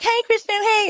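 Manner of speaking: high sing-song mock greeting; cops calling sweetly. Cops greeting her downtown, high mock-friendly call. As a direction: imitate cops sucking up. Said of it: "the cops were like".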